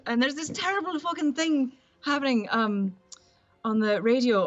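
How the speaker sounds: generic Irish dialect; Irish accent. Shown as a demonstration. Actress showing her Irish on request, Irish accent. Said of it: "[name], do an Irish accent".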